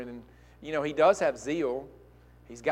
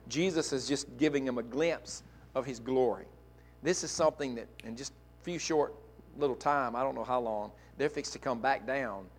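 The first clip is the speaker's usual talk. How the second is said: ordinary talk, nothing marked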